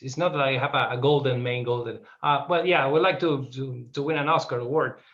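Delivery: usual speaking voice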